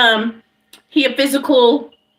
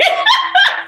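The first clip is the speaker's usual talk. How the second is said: shrill burst of laughter; high squealing laugh. Real, not acted. Telling friends the story, squealing laugh.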